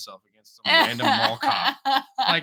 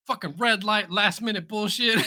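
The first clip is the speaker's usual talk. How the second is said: higher-pitched imitation of annoyance; mock frustrated grumbling; 8 semitones higher than usual. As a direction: imitate a driver cursing at traffic. Retold driver cursing red light.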